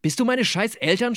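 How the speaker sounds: high-pitched, exasperated acted yell; narrated yell. A narrator's reading. Nicola yelling back at Gabi's scolding, exasperated yell. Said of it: "schrie ich".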